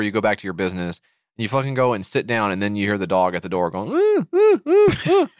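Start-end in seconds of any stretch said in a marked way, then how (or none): 3.9-5.3 high, loud whimpering dog; dog whine. Pretend. Dog whining at the door to be let in, high whimper. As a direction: whine like a dog shut outside.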